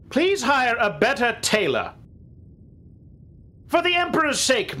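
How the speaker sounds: high-pitched, exasperated begging; exasperated plea. Acted. Passenger giving captain parting advice, exasperated plea. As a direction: build up in pitch, pleading.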